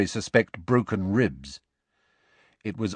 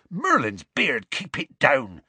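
urgent, raised-pitch hushing; hasty Hagrid; 5 semitones higher, slower than usual. A narrator's reading. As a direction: hush them in startled haste. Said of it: "said Hagrid hastily".

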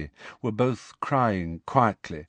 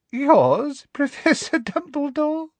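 much higher, with a nasty giggle; unpleasant little laugh; slower than usual. Acted. Umbridge mocking Dumbledore, high with a nasty little laugh. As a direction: scoff with a small mean laugh. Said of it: "with a singularly unpleasant little laugh".

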